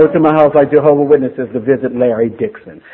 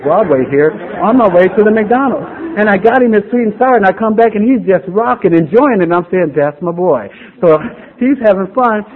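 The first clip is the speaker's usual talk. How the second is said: higher and louder, swept up in the memory; telling the crowd how he made it right, higher, louder, excited; excited storytelling; 6 semitones higher, 5 dB louder than usual. Real, not acted. An excited father telling the meeting, raised and eager.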